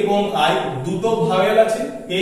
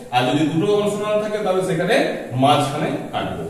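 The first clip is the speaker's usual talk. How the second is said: ordinary delivery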